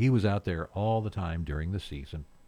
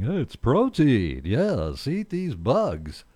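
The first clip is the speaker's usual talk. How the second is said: higher, louder, slow mock sales pitch; mock bug-eater pitch; 6 semitones higher, 7 dB louder, much slower than usual. Host mocking the bug-eating trend, slow hammed-up pitch. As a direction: parody an eager fan of eating bugs. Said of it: "People saying".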